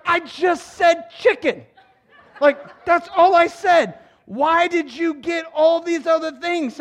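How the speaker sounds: imitated complaint, high and slow, drawn out; exasperated wife. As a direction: play a put-out wife stretching each word. Acts wife's complaint about shopping.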